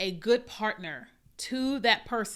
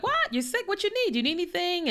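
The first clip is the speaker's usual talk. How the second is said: startled and worried, pitch jumping higher; retold, much higher surprised fuss; alarmed concern; 9 semitones higher than usual. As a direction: rush to ask what she needs, voice climbing. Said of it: "And I'm like".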